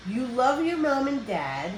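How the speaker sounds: usual speaking voice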